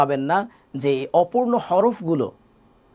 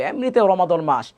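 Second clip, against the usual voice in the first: usual speaking voice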